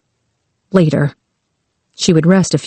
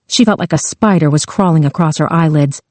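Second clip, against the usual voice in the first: ordinary delivery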